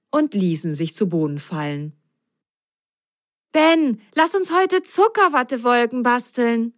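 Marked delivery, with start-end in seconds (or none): 3.5-6.7 bright, high little sock voice; sock girl voice. Acted. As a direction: play Biene the sock, eager. Biene speaks, high voice. (hohe Sockenmädchenstimme)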